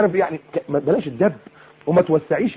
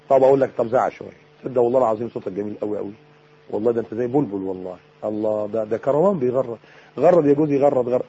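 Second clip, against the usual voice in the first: low, soft playful coaxing; sweet flattery; 5 semitones lower, 3 dB quieter than usual. Acted. Wife praising her shouting husband's voice to make him laugh, coaxing. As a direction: flatter tenderly, hushed and admiring. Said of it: "ما تتكلمي معاه كلام حلو".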